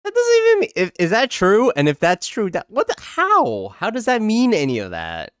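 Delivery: high-pitched incredulous squeal; stunned and confused by the definition; baffled outburst. Real, not acted. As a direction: voice shoots way up in disbelief. Baffled outburst at the AI's explanation.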